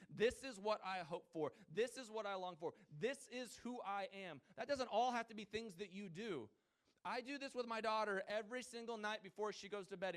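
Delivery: loud, high, driving sermon voice; preaching at full force. Acted. Pastor rousing the congregation, loud preaching. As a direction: preach it out to the whole room.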